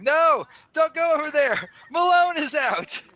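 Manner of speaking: high, loud, drawn-out cry of alarm; acted warning shout. Neighbour yelling to stop grandmother crossing to the duck, shouting. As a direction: shout a mock-frantic warning, stretching the words. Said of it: "dashed out shouting".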